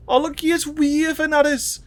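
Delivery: very high cooing hello; cutesy greeting. Pretend. Gamer greets an alien plant from Prime, very high coo. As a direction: greet it like a long-lost pet, squeaky.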